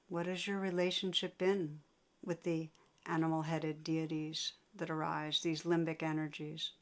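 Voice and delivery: unhurried meditation guidance with long pauses; guided meditation. Real, not acted. Teacher guiding listeners to sense painful emotions, guided meditation. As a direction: lead them inward, leaving long silences between phrases.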